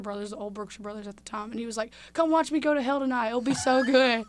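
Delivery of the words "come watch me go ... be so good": higher, louder hyped-up invitation; excited hype. Retold or imitated. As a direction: sell it like a carnival barker. Kyler inviting shoppers to the play.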